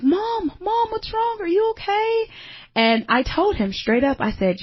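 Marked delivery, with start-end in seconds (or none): 0.0-2.3 put-on much higher child voice, worried; son's worried voice. Son finding his mother crying, asking if she is okay in a high child voice. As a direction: play the little boy asking if mom is okay. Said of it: "my son's like".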